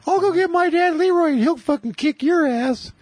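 put-on very high, squeaky boy's voice; Randy's high voice. Randy bragging about his dad, squeaky high boy voice. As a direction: brag like a kid whose voice hasn't dropped. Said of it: "He had a high voice".

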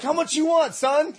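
high, keen haggling; acted-out excited buyer; retold eager deal. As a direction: ask eagerly, pitched well up. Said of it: "And I was like".

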